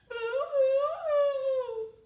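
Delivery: loud, high, drawn-out boo-hoo; mock crying. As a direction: wail playfully as the sad mama cow. Mama cow crying in song.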